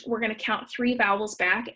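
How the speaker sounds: ordinary talk, nothing marked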